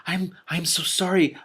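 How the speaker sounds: slow, stammering sorry; girl stumbling out an apology; apologetic. A narrator's reading. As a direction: apologise in a fluster. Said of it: "apologized the ordinary princess".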